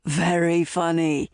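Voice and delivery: mildly wry, easygoing reply; good-natured. A narrator's reading. Son answering his mum's joke, good-natured. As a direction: take the joke lightly, as the son. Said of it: "Harry said good-naturedly".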